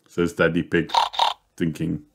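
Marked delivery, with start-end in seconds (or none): none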